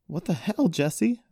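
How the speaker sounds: high, loud, startled protest; indignant yell. A narrator's reading. Brother just attacked by his sister, indignant yell. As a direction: yell in disbelief at your attacker. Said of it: "Isaac yelled as he quickly got".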